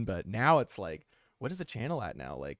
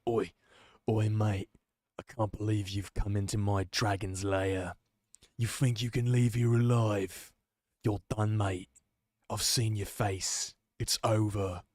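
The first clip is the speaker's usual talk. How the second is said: hammed-up British bloke accent for a dragon; a dragon threatening in a jokey, badly done British accent. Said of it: "a really bad British accent".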